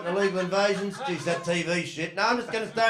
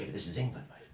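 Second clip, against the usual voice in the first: a hint of a hushed Liverpool mate, lower and softer; low confidential explaining; 11 semitones lower, 12 dB quieter than usual. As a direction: lean in and explain it quietly, mate to mate.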